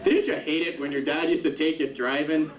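ordinary talk

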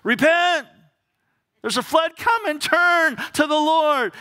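high, loud cry of Noah warning the crowd; Noah preaching. Acted. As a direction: shout it like a prophet calling out. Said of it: "Noah's out there".

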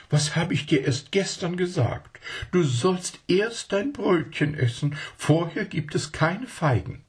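high prim lady doting and scolding; lady talking to her pet monkeys; fussy lady. Acted.

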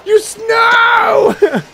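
high, drawn-out wail; dismayed cry. Real, not acted. Player sees the move go wrong, drawn-out cry. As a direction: break off into a long "no".

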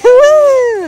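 high, loud whoop of delight; excited cheer. Real, not acted. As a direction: cheer the jump like a fan. Cheering kids jumping in leaves.

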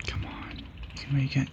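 whispered urging, barely audible; tense whisper. Real, not acted. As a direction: whisper it, holding your breath. Tense moment in game.

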